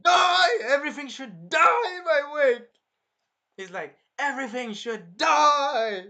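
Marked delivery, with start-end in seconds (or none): 0.0-2.7 high, very loud crazed roar; enraged scream. Retold or imitated. Hero goes full nuts, screaming in rage. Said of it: "he gets angry. He gets full on nuts". 4.2-6.0 loud, high and drawn-out raging cry; enraged scream. Retold or imitated. Retelling the hero going nuts, furious yell. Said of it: "he went full nuts".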